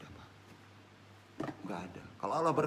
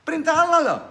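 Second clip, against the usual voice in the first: preaching exclamation; 13 semitones higher, 10 dB louder, faster than usual. Acted.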